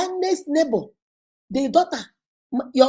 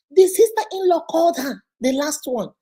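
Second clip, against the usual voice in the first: usual speaking voice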